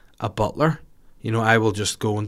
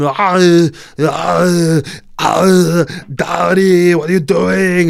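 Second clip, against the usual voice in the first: put-on high, loud baby wails into Daddy; shrill loud infant cry-talk; imitating a baby; 8 semitones higher, 10 dB louder, much slower than usual. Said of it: "a one-year-old just going".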